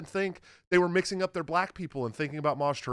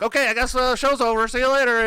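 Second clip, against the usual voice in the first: higher, louder mock give-up voice; defeated host; 9 semitones higher, 6 dB louder than usual. The host mimicked quitting the show, mock give-up. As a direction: throw in the towel in a put-on voice. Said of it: "And he's like".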